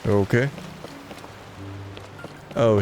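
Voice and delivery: normal talking voice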